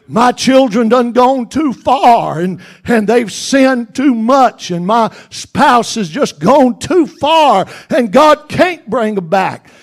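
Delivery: a hint of a despairing church member, loud; doubting believer. Preacher voicing a doubting believer, loud and pleading. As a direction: voice the doubter who's given up.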